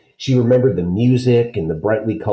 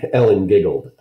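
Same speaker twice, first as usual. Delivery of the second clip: ordinary delivery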